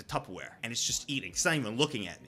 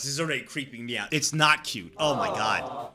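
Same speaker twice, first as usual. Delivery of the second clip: normal talking voice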